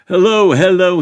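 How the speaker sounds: loud, high mocking call from an old gaffer; jeering. A narrator's reading. Old gaffer jeers at the passing sheriffs, loud and high. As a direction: taunt the arrested party gleefully. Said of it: "he jeered".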